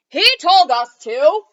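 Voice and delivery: blaming shout. Acted. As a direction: yell it as you point at the king. Knights blame the king.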